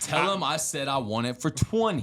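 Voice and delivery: George. Acted. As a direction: haggle stubbornly in a mock Jersey voice. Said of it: "George's like".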